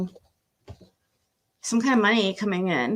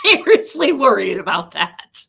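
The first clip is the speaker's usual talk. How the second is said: laughing out seriously worried, a touch louder; nervous laugh; 6 dB louder than usual. Real, not acted. Worried the cards will make her cry, nervous laughing. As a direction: laugh through the worry. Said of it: "I'm s".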